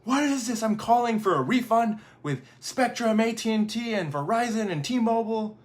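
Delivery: high, loud mock outrage; indignant customer. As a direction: rant like an impatient caller. Acts out impatient internet customer's complaint.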